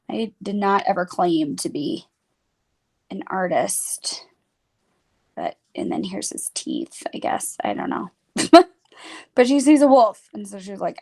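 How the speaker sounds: normal talking voice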